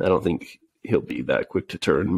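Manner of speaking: ordinary talk, nothing marked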